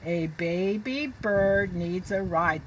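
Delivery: very slow, careful read-aloud; teacher reading to children. Shown as a demonstration. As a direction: read it slowly for the class.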